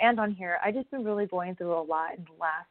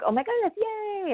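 a much higher, imitated squeal of delight; giddy cheer; 13 semitones higher than usual. Imitating her early thrill over the wrestling world, high giddy squeal.

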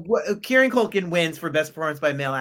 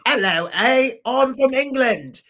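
put-on English accent, higher and louder; jokey English accent showing off being from England; mock English accent; 9 semitones higher, 7 dB louder, slower than usual. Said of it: "I love when they have English accents".

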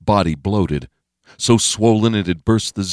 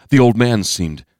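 plain reading voice

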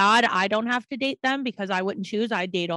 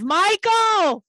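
playful yell; 10 semitones higher, 6 dB louder than usual. Pretend. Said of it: "That's what you get to yell".

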